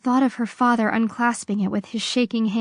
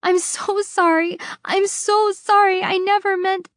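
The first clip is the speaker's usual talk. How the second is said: tearful pleading; 10 semitones higher, 3 dB louder than usual. A narrator's reading. Daughter pleading before execution.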